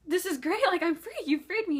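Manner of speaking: high, loud excited outburst; overjoyed relief. Retold or imitated. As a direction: gush with joy at being set free. Overjoyed at being freed in retelling.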